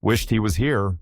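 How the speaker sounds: usual speaking voice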